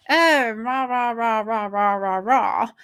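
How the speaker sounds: plummy, pompous rah-rah mimicry; mock posh blustering. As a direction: put on a toffee-nosed British accent. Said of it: "I'm putting on my plummy voice there".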